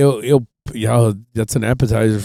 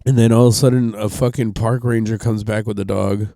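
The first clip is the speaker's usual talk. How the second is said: usual speaking voice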